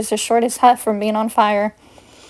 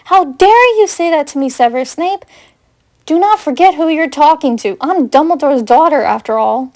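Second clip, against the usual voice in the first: furious; 4 semitones higher than usual. Acted. Paige rebuking Severus for insulting her late dad, furious. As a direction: put him in his place, outraged.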